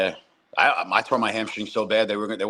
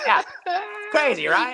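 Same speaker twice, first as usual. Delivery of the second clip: put-on old umpire voice. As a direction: play a cheerful half-dead old umpire. Continuing dead umpire impression.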